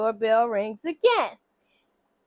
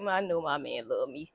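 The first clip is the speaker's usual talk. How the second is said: mock confident murmur; 10 semitones lower, 9 dB quieter than usual. Confident wife to herself about her husband, low and soft. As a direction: lower and softer, self-assured.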